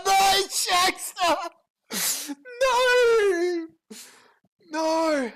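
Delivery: loud, high, drawn-out mock wail; hammed-up anguished cry. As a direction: overact despair, stretching the first No.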